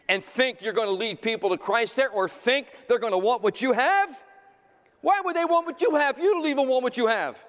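climbing to high, loud, indignant questions; full-force preaching. Acted. Preacher challenging churchgoers who badmouth their preacher, full-force preaching. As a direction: build to a ringing challenge.

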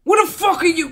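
high, loud outraged yell. Real, not acted. Viewer yelling at the screen, outraged. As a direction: let the outrage burst out.

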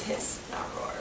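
hissing whisper. Retold or imitated. Retelling the snake's voice.

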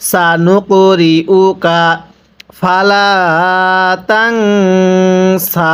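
slow drawn-out tajweed chant; Quran recitation. Shown as a demonstration. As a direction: stretch every vowel like a teacher reciting. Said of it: "কিভাবে পড়ব?".

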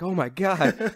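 mock shocked. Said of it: "They're like".

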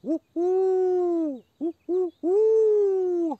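high, slow hooting owl. Shown as a demonstration. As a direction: hoot long like an owl. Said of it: "il suono di un gufo".

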